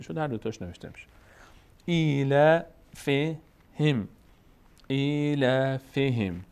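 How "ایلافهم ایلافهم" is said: "ایلافهم" very slow, syllable by syllable; model recitation. Shown as a demonstration. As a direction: stretch the long vowels in "ایلافهم" for learners to copy. Teacher models the word by syllables.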